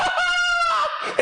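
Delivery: shrieking laugh. Real, not acted. Streamer reacting to the goal, shrieking laugh. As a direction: cackle with pure joy.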